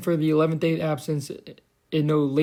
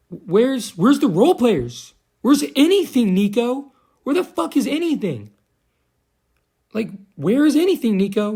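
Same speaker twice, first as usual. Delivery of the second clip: exasperated yell; 7 semitones higher, 4 dB louder than usual. Real, not acted. Fan yelling at GM Nico, exasperated loud outburst. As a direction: voice jumps high and loud, demanding answers.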